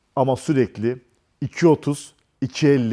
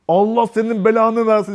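higher and louder, an imitated angry curse; mock curse; 7 semitones higher, 3 dB louder than usual. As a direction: play the girl cursing him to his face. Kız ona beddua ediyor. (kızın taklit edilen öfkeli bedduası)

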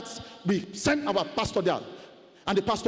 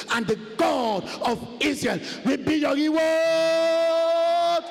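slow scripture proclamation; 3 semitones higher, much slower than usual. Acted. Preacher intoning scripture to his church, very slow. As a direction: stretch each word, then explode at the end.

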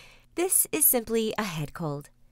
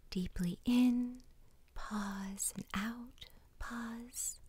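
guided meditation whisper; 9 dB quieter than usual. Acted. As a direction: whisper them gently into calm.

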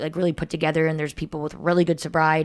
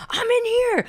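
very high, pleading trapped call; desperate cry. Retold or imitated. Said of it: "he would be like".

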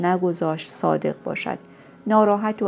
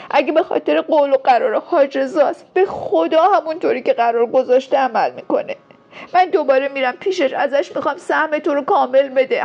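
very high, loud, anguished tirade; desperate crying yell, building higher; tearful shouting; 8 semitones higher, 7 dB louder than usual. A narrator's reading. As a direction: scream it out through tears, pleading and accusing. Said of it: "و گریه کرد و فریاد زد".

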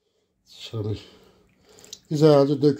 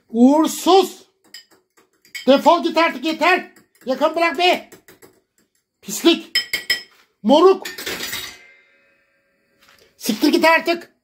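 high furious yelling, much higher pitch; angry shouting; 9 semitones higher than usual. Real, not acted. Yelling curses at the woman who sent the dishes, furious. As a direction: scream curses at her, hoarse with rage. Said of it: "beni bağırttırıyor burada ya".

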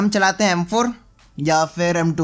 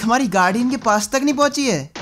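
ordinary talk

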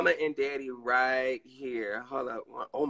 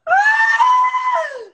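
high, loud shrieking laugh; shrieked laugh; loud burst of laughter; 12 dB louder than usual. Real, not acted. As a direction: let out a big delighted laugh. Laughs at own announcement.

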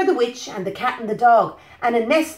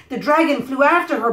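ordinary delivery, nothing marked

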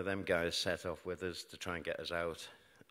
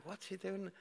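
much higher, soft puzzled question; 12 semitones higher, 4 dB quieter than usual. Retold or imitated. The patient, watching the doctor's back, asks in high puzzlement. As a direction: wonder aloud, baffled and uneasy. Wonders what doctor is doing.

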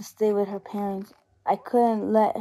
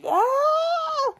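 sudden high shriek of fright; terrified scream; 4 dB louder than usual. Acted. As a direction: scream in terror at the walker. Attack in the bathroom.

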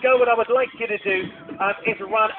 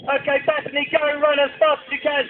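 higher, louder calls across the arena; calling out; 6 semitones higher, 4 dB louder than usual. Real, not acted. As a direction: urge her on from afar, loud and high. Cheering child running across arena.

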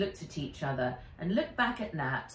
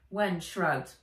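usual speaking voice

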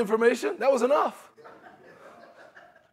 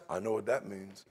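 low, very soft mutter; knowing assumption; 13 semitones lower, 13 dB quieter than usual. Acted. Preacher acting someone jumping to conclusions, low mutter. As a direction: mutter it low, as if already sure.

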